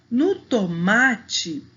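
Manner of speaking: "No tomate" slow, with a strong "ma"; "tomate" drawn out on the middle syllable "ma"; stress on penultimate syllable. Shown as a demonstration. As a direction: hit and stretch the "ma" in "tomate". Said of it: "a sílaba que a gente fala mais forte".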